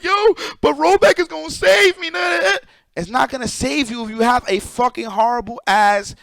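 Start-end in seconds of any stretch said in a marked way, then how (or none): 0.0-2.1 high, loud mocking shout of a clueless gamer; mock the people who think rollback saves them, much higher and louder; mocking gamer quote. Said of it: "People like". 2.1-2.6 scoffing denial. Real, not acted. Rebutting the mocked claim.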